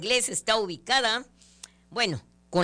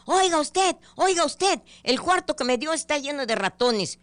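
much higher, louder angry complaint; angry shout; 9 semitones higher, 5 dB louder than usual. Retold or imitated. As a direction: yell at the hotel clerk. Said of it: "baja enojado gritando".

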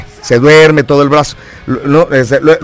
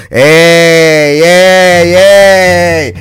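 excited cheer; much slower than usual. Retold or imitated. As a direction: cheer at the food like you can't eat more. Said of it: "así dije yo".

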